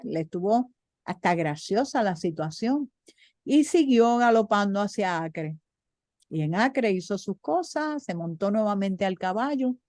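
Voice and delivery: usual speaking voice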